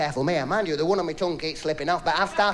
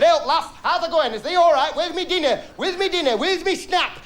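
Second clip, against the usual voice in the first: hearty Yorkshire husband voice, pitched up; 11 semitones higher, 3 dB louder than usual. Acted. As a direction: bellow it like a Yorkshire bloke home for tea. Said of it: "he were a Yorkshireman".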